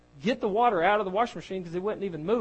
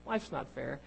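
ordinary talk, nothing marked

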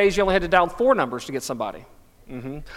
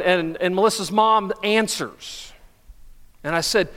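ordinary talk, nothing marked

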